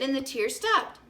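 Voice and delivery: plain reading voice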